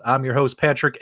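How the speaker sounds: normal talking voice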